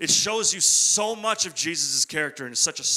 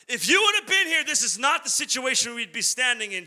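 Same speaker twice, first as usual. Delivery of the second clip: ordinary talk, nothing marked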